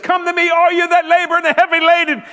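high loud ringing invitation; loud high sermon cry; preaching at full force. Acted. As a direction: call them in with full voice, slowing at the end. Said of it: "Who said this?".

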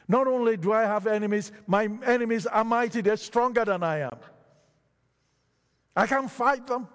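preacher voicing a desperate psalmist; psalmist crying out. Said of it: "he cries out".

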